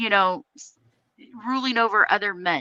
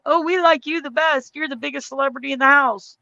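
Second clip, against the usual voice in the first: mocking voice; 5 semitones higher than usual. Mocking award givers.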